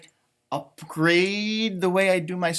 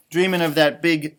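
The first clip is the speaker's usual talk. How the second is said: plain reading voice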